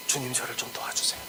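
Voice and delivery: hushed, breathless begging; whispered plea. Retold or imitated. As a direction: beg softly on your knees. Woman kneels, clutching Jesus's legs. (무릎 꿇은 여인이 예수님께 속삭이듯 애원)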